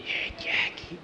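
hushed whisper to a friend. Acted. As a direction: whisper it secretly from hiding. Hiding, Skeeter whispers to Jackie.

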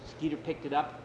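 usual speaking voice

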